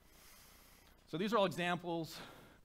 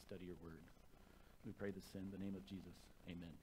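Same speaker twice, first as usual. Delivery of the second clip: quieter, earnest prayer voice; hushed pastoral prayer; opening prayer; 4 semitones lower, 6 dB quieter than usual. Acted. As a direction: pray softly over the congregation before the reading.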